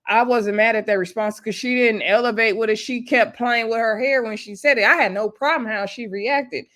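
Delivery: normal talking voice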